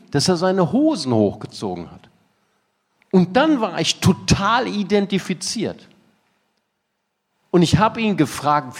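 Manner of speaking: ordinary talk, nothing marked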